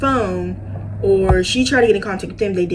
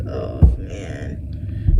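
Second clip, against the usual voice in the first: much lower mock doctor groan of dismay; doctor's low dismay. Doctor shaking his head, low dismayed voice. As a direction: sink the voice like a grim doctor. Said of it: "He's like".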